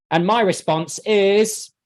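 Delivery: ordinary talk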